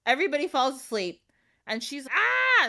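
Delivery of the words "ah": short high mock scream; a quick yelp of panic. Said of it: "So she starts screaming".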